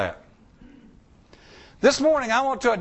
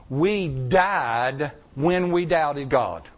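slow emphatic preaching; 7 semitones lower, much slower than usual. Real, not acted. Preacher driving home key point.

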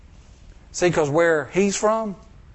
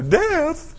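sudden very high voice; mock dread; 13 semitones higher than usual. Preacher imitating how people react to death, mock dread. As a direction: jump way up in exaggerated alarm. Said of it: "But to us, we go".

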